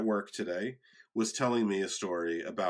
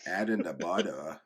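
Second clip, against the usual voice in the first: Elmer Fudd; 6 semitones lower, 3 dB quieter than usual. Acted. Cartoon hunter on a cooking show, low-voiced impression. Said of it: "making a cooking show".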